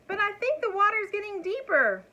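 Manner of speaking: high, loud worried dragon. A narrator's reading. Dragon to Elmer as the water rises, high and worried. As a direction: shriek with rising worry. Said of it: "shrieked the dragon".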